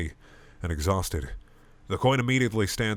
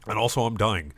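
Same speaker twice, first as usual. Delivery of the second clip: usual speaking voice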